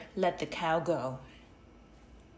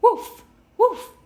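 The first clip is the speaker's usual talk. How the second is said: high, loud yapping woof; squeaky loud puppy bark; dog barking; 14 dB louder than usual. A narrator's reading. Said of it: "The dog said".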